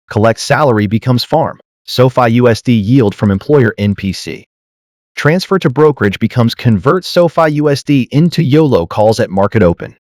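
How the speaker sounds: normal talking voice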